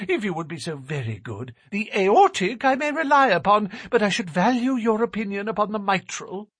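high, thin nervous little man. A narrator's reading. Narrator voices Thaddeus.

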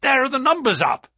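high, loud and quick; excited cry. Acted. Narrator spots the numbers at the racecourse, crying out. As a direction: call out urgently. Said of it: "I cried".

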